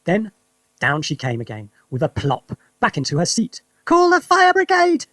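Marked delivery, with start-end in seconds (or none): 3.8-5.1 put-on old grandma shrieking high and loud, slowed; high, loud, slow alarm cry; sudden shout. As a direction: bellow it like a panicked old woman. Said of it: "she shouted suddenly".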